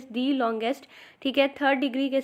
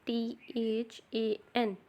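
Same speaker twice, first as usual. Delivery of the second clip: slow, softer letter-by-letter spelling; 8 dB quieter, much slower than usual. Shown as a demonstration. Teacher spells the correct word for learners, spelling out. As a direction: spell it slowly, one letter at a time.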